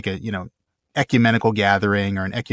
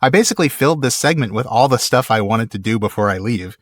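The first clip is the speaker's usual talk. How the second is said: ordinary talk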